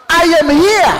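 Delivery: loud, high triumphant declaration; bold shout. Shown as a demonstration. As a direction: shout your presence like a lion's roar. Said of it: "You announce".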